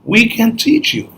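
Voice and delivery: high-pitched bird voice, rising; storybook birds climbing high; shorebirds. Acted. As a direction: offer help as little birds. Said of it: "And the birds go".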